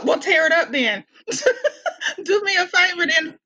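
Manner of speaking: laughing comeback. Retold or imitated. Driver asking officer to drop the ticket, laughing. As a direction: toss the retort out while laughing.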